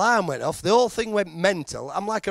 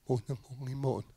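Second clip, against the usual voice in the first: mumbled tannoy noise; 4 semitones lower, 12 dB quieter, faster than usual. Retold or imitated.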